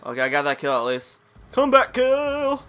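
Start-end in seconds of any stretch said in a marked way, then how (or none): 1.5-2.6 loud, high, drawn-out cheer; triumphant call. Real, not acted. Gamer celebrating a comeback kill, triumphant. Crows over a game kill.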